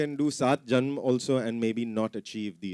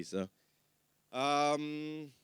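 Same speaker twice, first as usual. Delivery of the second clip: normal talking voice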